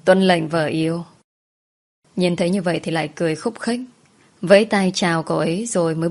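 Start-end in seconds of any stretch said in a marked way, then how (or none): 0.0-1.0 playful mock-formal salute to his wife; mock solemn reply. Husband jokingly obeys his wife, mock-serious tone. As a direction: answer like a soldier taking orders, teasingly. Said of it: "nghiêm trang trả lời".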